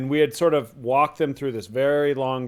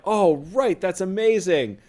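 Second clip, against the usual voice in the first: mock astonished; 6 semitones higher than usual. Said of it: "we're like".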